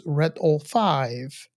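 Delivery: ordinary talk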